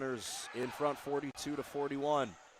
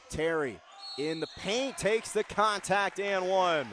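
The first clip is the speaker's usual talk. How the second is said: excited play-by-play; 4 semitones higher, 4 dB louder than usual. Real, not acted. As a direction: punch out the scoring play and stretch it. Live call of a scored basket.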